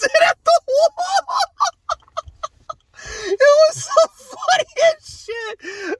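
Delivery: high, slow words through laughter; laughing. Real, not acted. Laughing about a friend's outburst, high and slow. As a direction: say it while cracking up.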